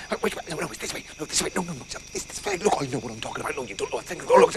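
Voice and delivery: low, very soft squabble; overlapping muttered argument in the dark; hushed bickering. Acted. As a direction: argue under your breath. Animals bicker, lost in the dark.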